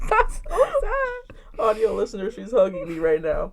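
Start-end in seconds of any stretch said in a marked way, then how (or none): 0.1-1.4 high, slow mock crying; fake-sob the words; hammed-up whimper. Friend mock-cries over her co-host's childhood, high and slow. Mock pity while hugging.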